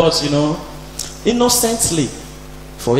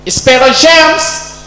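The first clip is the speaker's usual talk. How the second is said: commandant calling; 8 semitones higher, 3 dB louder, much slower than usual. Retold or imitated.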